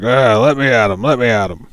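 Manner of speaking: Eric, eager to fight, loud and keen; eager brawler. Acted. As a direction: growl it out, straining to get at him. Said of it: "That was more like Brad".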